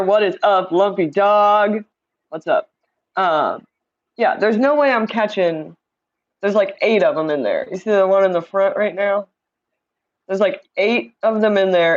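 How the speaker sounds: ordinary talk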